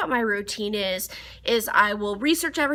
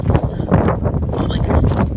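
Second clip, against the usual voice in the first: awed, breathy gasp at the snow; breathless gasp; 8 dB louder, much slower than usual. Real, not acted. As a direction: gasp it out, stunned. Stunned by the snow.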